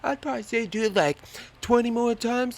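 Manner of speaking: high, loud, fast dude advice; imitating the instructor. Instructor advising the jumper, high and loud. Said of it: "He was like".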